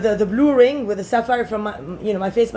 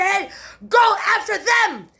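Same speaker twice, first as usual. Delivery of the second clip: raised, high-pitched demanding shout; angry command; 6 semitones higher than usual. Real, not acted. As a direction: issue a furious order, pitched high. Shouted order to go after them.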